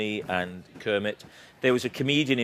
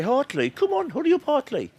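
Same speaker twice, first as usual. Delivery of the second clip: much higher, odd puppet pig voice; 13 semitones higher than usual. Retold or imitated. Pig hurrying Hartley along, high squeaky puppet voice. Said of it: "Pig talked like that".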